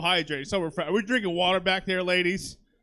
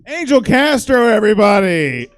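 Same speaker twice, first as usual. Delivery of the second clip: big, loud emcee call; announcer's shout; 3 semitones higher, 10 dB louder than usual. Real, not acted. Host sending off a comedian to applause, loud announcing. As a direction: belt out the name to raise applause.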